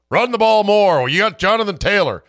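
loud, high armchair-fan yell; mock fan shout. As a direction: bark it like a fan. Mock fan yelling advice.

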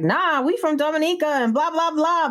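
hammed-up retold protest; went off. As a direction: huff back at her. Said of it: "my cousin was like, went off".

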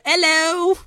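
high, hammed-up British hello; bad British accent. Comparing old and new slang, bad British accent. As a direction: sing out a mock British greeting, pitched high. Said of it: "that's my bad British accent".

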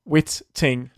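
Whitting broken up with a hard, separate T; emphatic T. Shown as a demonstration. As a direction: hammer the T in "Whitting". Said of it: "Just pronounce the T".

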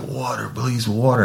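high, desperate begging for water; mock pleading. Suspect begging the officer for water, high and desperate. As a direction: beg like your face is on fire. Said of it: "he's like".

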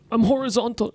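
high-pitched imitation of a British accent; mimicked Brit, pitched well up. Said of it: "weil sein Akzent ist das Einzige".

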